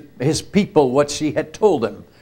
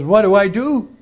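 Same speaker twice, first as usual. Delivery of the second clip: higher and louder, a hint of alarm; mock panicked question to the other priest; retold frightened plea; 6 semitones higher, 5 dB louder than usual. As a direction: ask it like a scared man begging. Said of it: "he's scared and afraid".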